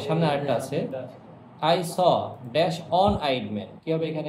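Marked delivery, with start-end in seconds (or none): 1.8-3.7 very slow, word-by-word reading; slow dictation. Shown as a demonstration. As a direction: read it out slowly for the class to follow. Teacher reads exercise sentence aloud. (ধীরে ধীরে বাক্য পড়া)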